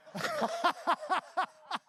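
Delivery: loud, high cackling laugh; loud high laughter. Real, not acted. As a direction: crack up at your own joke. Laughing at own bus joke.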